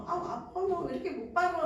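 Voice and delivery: a mild, whiny mock complaint of pain; a sore mother grumbling at her baby, half-hearted. Shown as a demonstration. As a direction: play the impatient mother, lightly. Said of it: "하면 그런 게 아니라".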